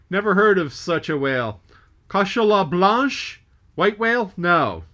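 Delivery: puzzled foreign mate calling back. Acted.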